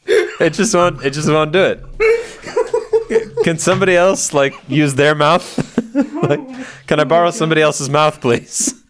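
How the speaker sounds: high, loud giggling through the words; can't get the words out for laughing; laughing fit. Real, not acted. Host cracking up after flubbing the intro, laughing fit. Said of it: "I can't stop laughing".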